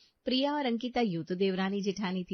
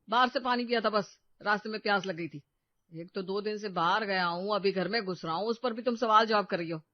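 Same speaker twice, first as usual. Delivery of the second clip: irritated outburst. Acted. Husband angrily brushing off wife's questions about drinking.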